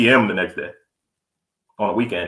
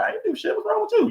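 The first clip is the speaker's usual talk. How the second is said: high, soft snippy retort; mock attitude; 5 dB quieter than usual. Mimicking his girl snapping back with attitude. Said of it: "like, with an attitude".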